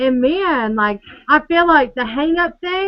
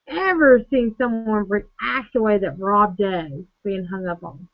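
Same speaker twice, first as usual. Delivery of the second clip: ordinary delivery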